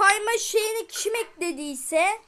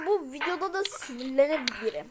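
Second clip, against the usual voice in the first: whispered warning to the viewers; whispered aside; 5 semitones lower, 6 dB quieter than usual. Real, not acted. Gamer confiding to viewers he may get angry, whispered. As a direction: let it slip out in a whisper.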